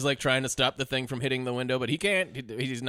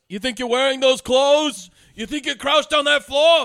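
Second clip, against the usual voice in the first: high, loud, slow sneering ghost; hammed-up mean subway ghost; mean ghost impression; 10 semitones higher, 7 dB louder than usual. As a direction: snarl it like a bitter, cranky ghost. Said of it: "Mean Ghost from Ghost".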